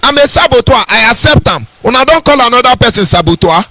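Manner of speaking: lifted pulpit delivery; fervent preaching. Acted. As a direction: preach it to the crowd.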